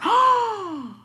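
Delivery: long, very high mock gasp; shocked gasp. Reacting to the rude driver's comment, shocked gasp. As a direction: gasp as if scandalized.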